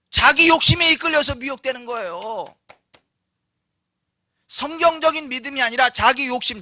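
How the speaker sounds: high, loud, insistent sermon delivery; forceful preaching. Real, not acted. Preacher rebuking self-deceived believers about temptation, insistent. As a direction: hammer out the correction.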